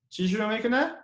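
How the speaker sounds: ordinary delivery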